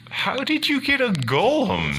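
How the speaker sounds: very high-pitched, baffled question; squeaky disbelief. Acted. Teammate asks the character about the golem, squeaking in disbelief. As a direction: pitch it way up in mock amazement.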